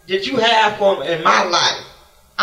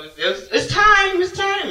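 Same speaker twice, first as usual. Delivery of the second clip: excited announcement; 11 semitones higher than usual. Retold or imitated. Doctors calling it's time.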